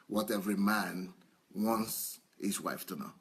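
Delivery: normal talking voice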